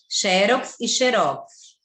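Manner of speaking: first "xerox" stressed on XE, then "xerox" stressed on ROX; slow, deliberate contrast between the two "xerox"; two pronunciations. Shown as a demonstration. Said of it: "têm dupla pronúncia". (primeiro "xerox" com tônica em XE, depois "xerox" com tônica em ROX)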